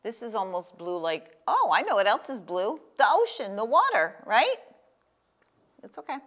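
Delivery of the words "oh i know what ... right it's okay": ordinary talk, nothing marked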